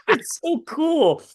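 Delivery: very high, drawn-out delight; slow high-pitched marvelling; delighted awe. Retold or imitated. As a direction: let the voice soar, slowly, with wonder. Recounting grandson's awe, voice soaring.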